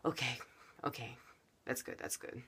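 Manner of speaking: very soft, breath-spent murmur; calming down. Retold or imitated. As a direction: settle down after heavy breathing, acted. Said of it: "get lighter".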